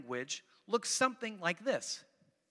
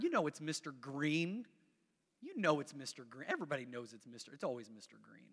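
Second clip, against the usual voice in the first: knowing aside; 7 semitones lower, 6 dB quieter than usual. Acted. Knowing aside about the game's culprit.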